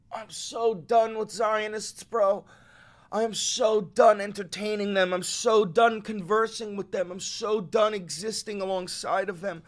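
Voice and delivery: furious rant. Real, not acted. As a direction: spit every "done" out in rage. Furious rant after the letter.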